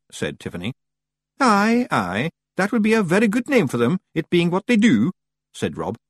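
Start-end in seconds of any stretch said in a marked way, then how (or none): 1.4-5.1 high Scots Feagle voice; high-pitched Scottish character voice; agreeable Feagle leader. A narrator's reading. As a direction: agree heartily as a wee Scotsman.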